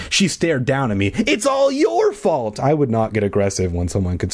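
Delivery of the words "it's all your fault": high, drawn-out mock accusation; accusing. A narrator's reading. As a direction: stretch it out as a high-pitched blame. Acted accusation in story.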